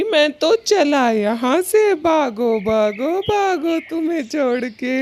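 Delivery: high, hurried panicky bolt; panicked getaway. Acted. Kalu abandoning Gauri as he runs, panicky. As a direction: flee in a hammed-up panic.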